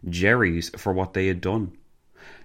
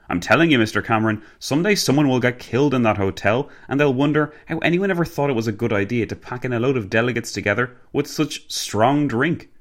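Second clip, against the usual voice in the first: higher-pitched animated complaint; Arthur, comic grumbling; 5 semitones higher than usual. Acted. Driver complaining of his hotel to the passenger, grumbling. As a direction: grumble about it with gusto.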